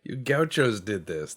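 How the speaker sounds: loud, high blaming call; mock accusation. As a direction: point the finger theatrically. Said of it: "I'll be like".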